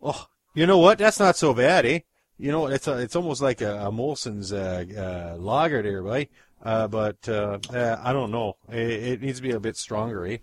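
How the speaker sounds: Canadian dude pleading for help; kidnapped Canadian begging in a Canadian accent; Canadian alien. Acted.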